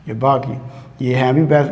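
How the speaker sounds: ordinary talk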